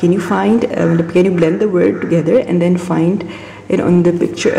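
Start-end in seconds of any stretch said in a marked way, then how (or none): 1.1-4.6 slow. Shown as a demonstration.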